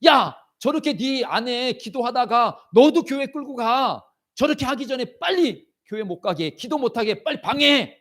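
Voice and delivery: high-pitched, urgent orders; alarmed devil. Acted. Startled devil ordering church and prayer blocked, alarmed. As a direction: bark panicked commands to a helper. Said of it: "악한 원수 마귀가 막 놀란 거야".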